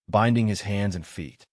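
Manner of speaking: usual speaking voice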